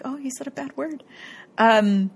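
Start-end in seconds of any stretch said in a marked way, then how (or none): 0.0-1.0 softer, higher attempt at an Irish accent; Gary. Host retries the quote, soft mock Irish accent. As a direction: quietly mimic an Irish accent. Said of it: "I can't do an Irish accent".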